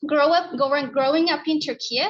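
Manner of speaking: speaking up. Real, not acted. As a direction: push the volume so the back row hears. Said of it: "Just speak louder and just speak closer".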